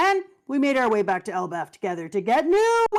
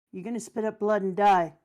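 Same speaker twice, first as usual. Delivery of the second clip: ordinary talk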